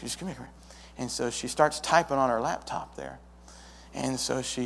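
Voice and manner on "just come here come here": secretive librarian, much softer; a librarian hushing behind her desk; whispering. Retold or imitated. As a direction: beckon him close in a hush. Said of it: "she whispers up behind the desk".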